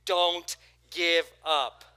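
slow, loud don't give up; loud deliberate call to the neighbor; emphatic call. Shown as a demonstration. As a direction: say it to your neighbor with force. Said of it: "Look at your neighbor and say".

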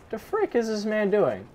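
high, cracking exasperated question. Real, not acted. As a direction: let the voice break in bewilderment. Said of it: "the voice crack though".

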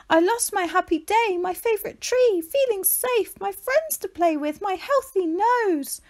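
a little creature pouring out losses; high sad tortoise; high small tortoise voice. A narrator's reading. Shelley's voice tumbling out.

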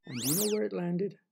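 ordinary delivery, nothing marked

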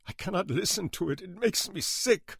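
put-on high, accented, bitter outburst; trembling rage. A narrator's reading. Older man telling why he hates music, trembling rage. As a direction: rage rising in pitch to the end. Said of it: "face twisted in trembling rage".

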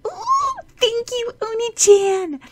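very high, loud squeal of delight, slowing on the name; excited squeal. Acted. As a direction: squeal with joy like a little girl hugging a new doll. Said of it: "squealed excitedly".

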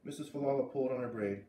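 ordinary delivery

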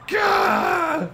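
high, loud sudden "gah"; frustrated yell. Real, not acted. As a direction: explode in a short cry.

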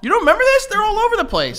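high, loud incredulous; can't believe he doesn't remember; disbelieving. Real, not acted. Disbelief at friend.

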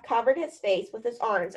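ordinary delivery, nothing marked

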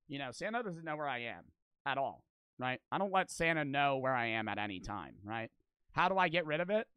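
ordinary talk, nothing marked

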